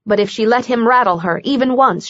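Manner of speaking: usual speaking voice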